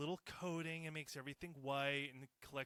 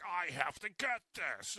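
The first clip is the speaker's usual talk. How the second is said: mock rude shopper; 4 semitones higher, 5 dB louder than usual. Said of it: "rude fucks that are like".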